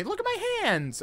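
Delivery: horrified at the mess; disgusted shock. Real, not acted. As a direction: pitch jumps up in dismay. Disgusted at bloody hands.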